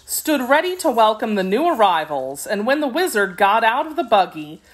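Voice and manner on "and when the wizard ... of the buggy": ordinary delivery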